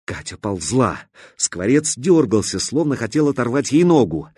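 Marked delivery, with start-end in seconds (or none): none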